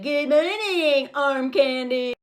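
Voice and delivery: put-on slow, drawn-out old lady greeting. Old lady greeting him on his way out, mock leering. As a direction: stretch out each word like a leering old woman. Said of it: "that old lady is like".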